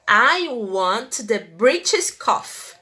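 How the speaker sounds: slow and deliberate, every word clear; teacher modeling the sentence. Shown as a demonstration. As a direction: read slowly for learners. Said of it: "teacher reads example".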